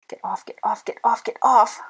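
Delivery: frantic plea, rising in pitch and force; desperate repeated pleading; begging. A narrator's reading. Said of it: "I begged".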